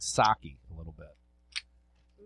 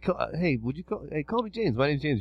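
higher mock voice of Jimmy asking to be called James; imitating Jimmy; 5 semitones higher than usual. Podcast host mocking listener Jimmy, in a high pleading mock voice. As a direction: play the friend pleading to be called James, pitched up. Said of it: "he's like".

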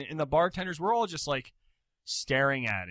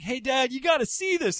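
put-on much higher kid calling his dad; excited kid voice; 10 semitones higher, 4 dB louder than usual. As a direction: pipe up like a little boy eager to show Dad. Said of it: "They're like".

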